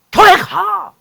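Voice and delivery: high, loud snapped command; family member yelling at kin to go to church; angry order. Retold or imitated. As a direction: bark it at a relative. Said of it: "성기를 내면서 막".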